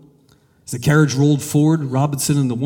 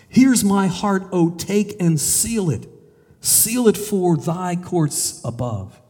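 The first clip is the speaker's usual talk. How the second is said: slow, raised, reverent recitation; slow hymn reading; 5 semitones higher, slower than usual. Acted. Preacher reading hymn to congregation, slow and raised. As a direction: lift the words up slowly like a prayer. Hymn lines read slowly.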